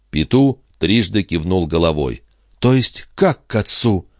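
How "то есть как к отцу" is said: higher-pitched baffled question; narrated heroine's mild disbelief; growing amazement. A narrator's reading. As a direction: ask it back in mounting astonishment. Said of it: "всё более изумляясь, переспросила Катрин".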